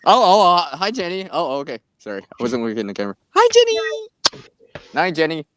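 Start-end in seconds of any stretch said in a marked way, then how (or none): 3.4-4.5 very high, slow sing-song hi; drawn-out squeaky high greeting. Pretend. Greeting Jenny in funny voice.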